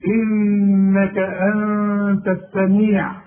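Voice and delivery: "إنك أنت" slow and loud with long nasal n's; held ghunna. Shown as a demonstration. Teacher demonstrating the nun, held ghunna. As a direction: hum long on both nuns of "إنك أنت". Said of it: "النون لازم تغن".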